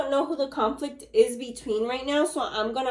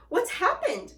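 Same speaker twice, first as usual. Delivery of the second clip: higher, shocked cry; mock scream; 8 semitones higher than usual. Missus Twit shocked at the upside-down room, higher. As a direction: shriek in disbelief. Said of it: "screamed Missus Twit".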